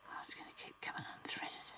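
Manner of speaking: soft whisper to herself; frustrated whisper to the thread. Real, not acted. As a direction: breathe it out under her breath. Muttering at the needle while sewing.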